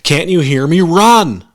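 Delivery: loud, high, urgent yelling; yelled warning to someone slow to react; forceful shout. A narrator's reading. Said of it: "he repeated forcefully".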